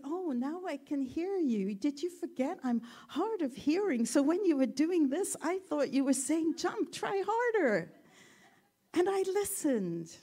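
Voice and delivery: lightly voiced cheerful frog explaining; happy deaf frog. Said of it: "he said".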